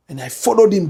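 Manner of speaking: normal talking voice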